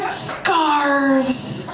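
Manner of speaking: ordinary talk, nothing marked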